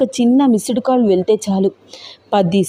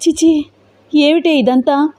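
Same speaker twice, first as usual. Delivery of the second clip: higher, appalled exclamation; shocked disgust; 7 semitones higher than usual. A narrator's reading. Teja reacts to the vulgar video, shocked and disgusted. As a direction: recoil in disgust at what she sees. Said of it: "అంటూ ఒక్కసారిగా రూపని దగ్గరికి".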